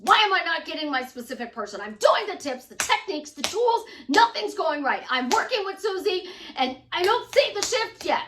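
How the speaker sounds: loud, high mock complaint; upset client. Coaching client complaining that nothing is going right, mock upset. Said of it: "they will get upset".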